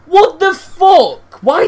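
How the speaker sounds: loud, high, slow furious shout; laughing rage. Real, not acted. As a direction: explode in disbelieving rage. Raging at a lost game.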